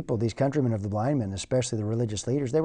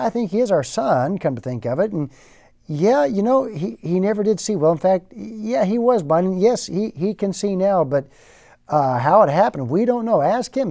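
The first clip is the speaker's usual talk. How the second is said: higher, hedging parents dodging the question; nervous parents passing the buck, voiced higher; evasive parents; 6 semitones higher, 4 dB louder than usual. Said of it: "they plead ignorance".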